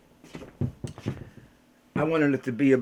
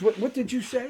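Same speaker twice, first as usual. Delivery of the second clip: higher puzzled question; imitating Oprah; 9 semitones higher, 4 dB quieter than usual. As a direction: ask back, baffled. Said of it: "Oprah goes".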